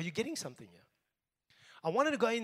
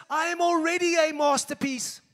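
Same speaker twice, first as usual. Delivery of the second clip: louder, higher faith declaration; proclaimed loud; 8 semitones higher, 9 dB louder than usual. Shown as a demonstration. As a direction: shout it out with passion. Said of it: "Say it like this".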